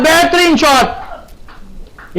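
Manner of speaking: very slow, high, drawn-out shout; stretched-out praise. Real, not acted. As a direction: savour each syllable of बेहतरीन. Boundary shot, commentator savours it. (धीमी खिंची हुई उत्साहित आवाज़)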